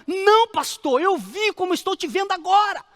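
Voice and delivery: high, loud frightened protest; hammed-up pleading, pitched high; dramatized desperate insistence. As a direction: insist in mock panic that it was real. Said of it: "vou dramatizar mais, porque eu gosto de dramatizar".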